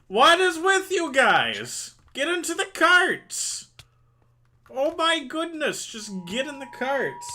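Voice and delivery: high exasperated shout; frustrated yelling. Real, not acted. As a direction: yell at them in frustration. Yelling at mobs.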